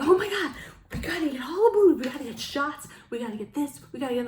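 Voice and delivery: very high and loud, rushing mock panic; imitating frenzied drinkers at last call; frantic last-call excitement. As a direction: gush like a drunk fan grabbing booze. Crowd mimicked at last call.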